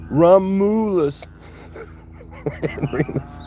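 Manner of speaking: Romulus stretched out slowly, then laughing into and Remus; slow drawn-out name. Shown as a demonstration. Naming the two calves, drawn out slowly with a laugh. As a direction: savour the name, slow and grand. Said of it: "I'm gonna call them two".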